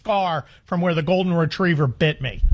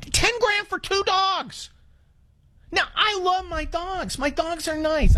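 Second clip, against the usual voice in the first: pained exclamation; 10 semitones higher than usual. Real, not acted. Exasperated over dog costs.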